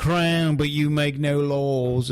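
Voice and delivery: lightly put-on British accent, taunting; half-hearted British accent mocking the crown. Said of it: "your British accent's fucking weird".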